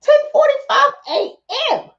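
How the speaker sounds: high, disbelieving mock squeal; exaggerated high shocked voice; incredulous. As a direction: pitch way up, baffled at someone's morning rage. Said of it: "I'm like".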